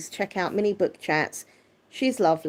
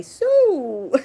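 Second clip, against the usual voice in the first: higher, louder, sung-out Sue; imitating a viewer saying Sue; 9 semitones higher, 6 dB louder than usual. Teasing a viewer about how she greets her, higher and louder. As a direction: copy the viewer's cheery Sue. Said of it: "You say".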